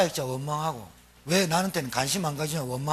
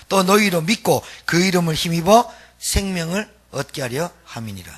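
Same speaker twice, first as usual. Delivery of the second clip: steady, louder scripture read; 3 semitones higher, 7 dB louder than usual. Acted. Preacher reading the verse to the congregation, solemn and louder. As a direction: read the verse out with weight to the flock. Reads John 20:31 aloud.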